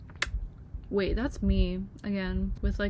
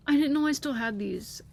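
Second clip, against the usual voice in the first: higher and louder, thrilled disbelief; excited; 5 semitones higher, 4 dB louder than usual. Real, not acted. Still reeling from finding old drawings, excited. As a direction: blurt it out amazed.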